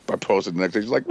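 normal talking voice

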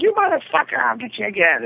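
high-pitched comic rage at the cat; mock furious threat.

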